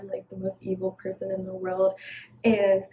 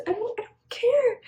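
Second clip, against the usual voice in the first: sudden much higher squeal of not caring; high exasperated. Real, not acted. Exasperated brush-off of an ex.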